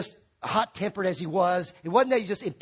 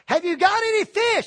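higher, louder call across water; 11 semitones higher, 5 dB louder than usual. Retold or imitated. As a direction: call out to the boat from the shore. Customer calling to boats.

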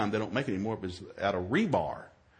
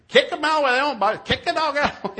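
high, loud frantic holler; hammed-up high shout; acted shouting at dogs; 10 dB louder than usual. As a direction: yell over a snarling dog.

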